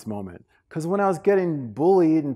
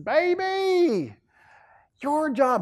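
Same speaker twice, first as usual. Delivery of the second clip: high-pitched mother voice; mama's advice; 13 semitones higher, much slower than usual. Retold or imitated. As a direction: counsel your son as mama, pitched high. Voicing his mother advising him.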